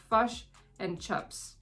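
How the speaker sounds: imitated Kiwi "fush and chups", both short i's as uh; switched Kiwi vowels. Shown as a demonstration. American vlogger who feels ridiculous saying it, slow mock Kiwi pronunciation. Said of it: "I feel ridiculous saying it".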